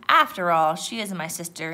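ordinary delivery

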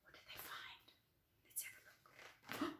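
very soft, hushed wondering; secretive whisper. Pretend. Storyteller asking children what was found, secretive whisper.

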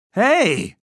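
loud, high indignant call; angry shout. A narrator's reading. Erik to the girls after falling, angry shout. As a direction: shout it in annoyance. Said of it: "rief er wütend".

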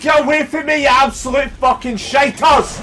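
high-pitched exasperated outburst; real irritated yell; angry cursing. Real, not acted. Curses attacking enemies.

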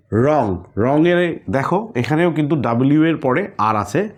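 normal talking voice

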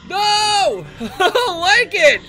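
loud, high laughing protest; panicked laughter. Real, not acted. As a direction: laugh through the fright.

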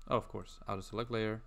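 ordinary talk

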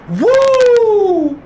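high, loud victory whoop. Real, not acted. Gamer whooping after smoking his rival, loud. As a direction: let out a wild cheer. Celebrating a kill.

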